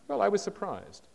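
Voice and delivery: normal talking voice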